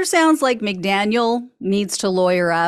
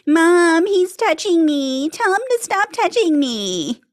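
put-on high, whiny kid tattling to mom; whiny tattling little sibling; 9 semitones higher than usual. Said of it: "the legal equivalent of, like".

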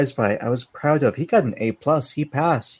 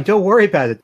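higher and louder, pushed through tears; acted tearful; 5 semitones higher, 5 dB louder, much faster than usual. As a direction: brave it out while crying. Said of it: "as tears come, like, gushing".

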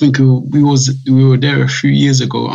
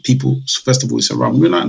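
ordinary delivery

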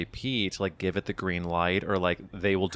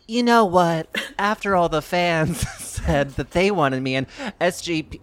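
mock gracious change of heart, loud and very high; big hammed-up put-on celebrity voice; Kim Cattrall; 9 semitones higher, 6 dB louder than usual. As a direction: proclaim it high and loud, mockingly. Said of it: "would Kim Cattrall be like".

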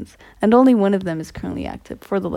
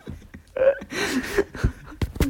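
high rising laugh; 12 semitones higher than usual. Real, not acted. Host still laughing at the guest's joke. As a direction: keep laughing.

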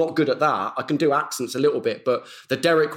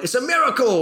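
mock miracle cry; 10 semitones higher, 3 dB louder than usual. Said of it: "just stood up and went".

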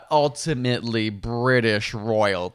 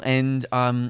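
normal talking voice

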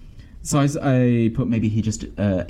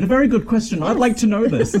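mock outrage; 10 semitones higher than usual. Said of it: "And I said".

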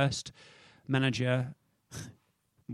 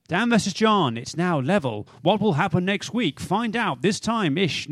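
announcer voice; 4 semitones higher, 3 dB louder, slower than usual. Acted. Closes segment like a show announcer.

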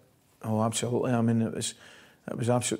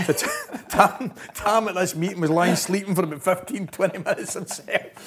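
laughing; 6 semitones higher, 5 dB louder than usual. Real, not acted. Coach recalling Tam asleep in a meeting, laughing. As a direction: struggle to get the words out for laughing. Laughing at his sleeping colleague story.

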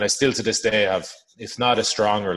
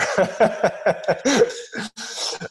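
high laugh; 14 semitones higher, 4 dB louder than usual. Real, not acted. Laughing after rowing story.

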